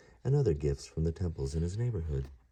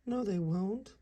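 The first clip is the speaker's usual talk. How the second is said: much higher squeaky comforting voice; squeaky mouse; slower than usual. Acted. Mouse comforting Ganesha, a high squeak. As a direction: reassure him in a tiny mouse voice. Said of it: "said Mister Mouse".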